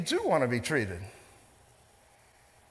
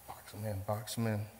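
muttered aside; 5 semitones lower, 10 dB quieter than usual. Real, not acted.

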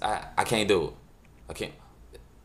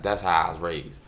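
ordinary talk, nothing marked

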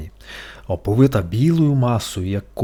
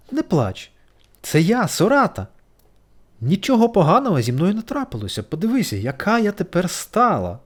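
gentle butterfly; 5 semitones higher than usual. A narrator's reading. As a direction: soothe him kindly. Butterfly comforting beetle. (метелик втішає жука)

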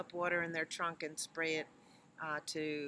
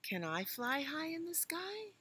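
high, dreamy little elephant; high child voice; 11 semitones higher than usual. Acted. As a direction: ask like a hopeful calf.

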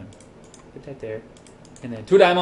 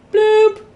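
loud, high-pitched cartoon bloop; high bloop noise; 9 dB louder than usual. Real, not acted. Gamer placing a block, high playful bloop. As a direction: pop out a squeaky little placing sound. Voices an item being placed.

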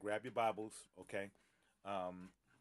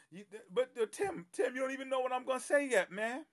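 higher, louder, fast laughing protest; excited protest; 8 dB louder than usual. Real, not acted. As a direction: playfully fend off the teasing. Protesting Tim's teasing.

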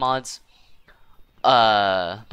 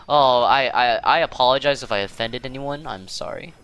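ordinary talk

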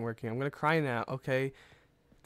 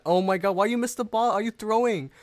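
higher, louder incredulous outburst; exasperated disbelief; 6 semitones higher, 4 dB louder than usual. Real, not acted. Commentator accusing a player over a missed ball, exasperated. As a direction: call out the blunder in disbelief.

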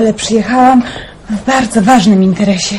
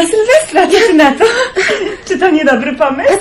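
laughing tease; 6 semitones higher than usual. Acted. Cheerful surprise visit announcement.

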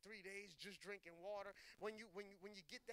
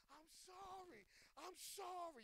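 high, wailing cry without full volume; high-pitched, drawn-out mock scream; retold screaming apology; 10 semitones higher, slower than usual. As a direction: re-enact a desperate cried-out plea. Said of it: "the way I was screaming".

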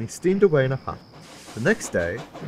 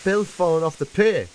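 higher, urgent report; urgent news; 8 semitones higher, 4 dB louder than usual. A narrator's reading. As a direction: break alarming news, pitched up.

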